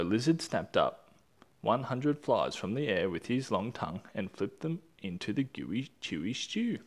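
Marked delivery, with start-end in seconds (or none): none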